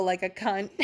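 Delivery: ordinary delivery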